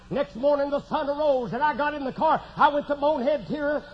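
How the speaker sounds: ordinary talk, nothing marked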